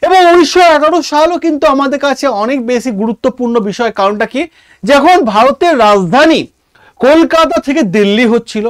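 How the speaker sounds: usual speaking voice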